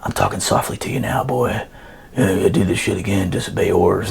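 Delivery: low, hushed sergeant major voice; imitated menacing whisper. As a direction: mimic a superior murmuring threats right into an ear. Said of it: "he whispers and he's like".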